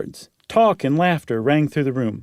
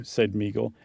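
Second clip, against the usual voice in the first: ordinary talk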